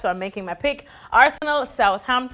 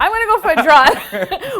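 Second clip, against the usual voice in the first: repeated louder through a laugh, rising on go; 8 semitones higher, 7 dB louder than usual. Real, not acted. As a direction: laugh it out cheekily while dodging the question. Why is that?.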